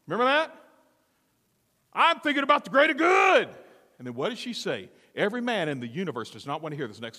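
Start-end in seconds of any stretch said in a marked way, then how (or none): 1.9-3.5 high, loud, indignant protest; Frozone shouting. Acted. Husband defending himself to his wife, shouting. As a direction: yell it in exasperation. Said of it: "that Frozone had".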